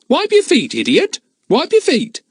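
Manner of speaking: very high, loud parrot screech; parrot squawk. Acted. Kiki the parrot squawking again in the tunnels. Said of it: "Kiki's voice came again".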